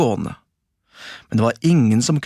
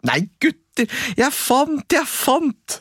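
high, eager sing-song call; a boy crowing over his find; 11 semitones higher, slower than usual. A narrator's reading. Askeladden calling to his brothers, high excited cry. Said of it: "ropte han".

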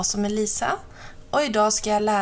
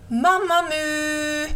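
high, drawn-out call; 6 semitones higher, slower than usual. A narrator's reading. Crow calls for missing Mamma Mu, long high call. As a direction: stretch the name to carry across a field. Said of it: "Han reste sig upp och ropade".

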